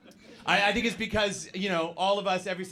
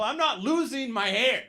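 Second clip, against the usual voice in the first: high, insistent protest; mock-defensive insistence, pitch climbing; in denial; 7 semitones higher than usual. Balding comic telling people he's fine, in denial. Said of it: "I was in denial".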